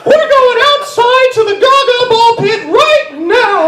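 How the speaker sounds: high, loud screaming mouse; Mickey Mouse raging at the class; furious Mickey Mouse. Pretend. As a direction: lose it as Mickey. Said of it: "Mickey Mouse... freaked out".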